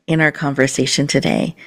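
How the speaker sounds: normal talking voice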